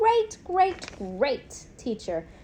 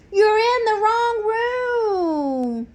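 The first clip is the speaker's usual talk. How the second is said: high, loud, slow squeaky protest; squeaky hamster; 9 semitones higher, 5 dB louder, much slower than usual. A narrator's reading. Hamster squeaks at new students who are in the wrong room. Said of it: "I squeaked".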